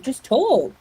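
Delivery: exasperated. Retold or imitated. Exasperated retold reaction to a character.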